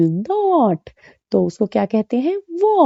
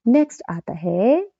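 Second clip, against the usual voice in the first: usual speaking voice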